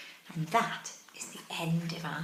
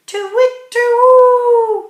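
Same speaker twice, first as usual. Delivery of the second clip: loud, high, hooting owl call; 20 dB louder, much slower than usual. A narrator's reading. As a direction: hoot it out like an owl. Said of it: "went the owl".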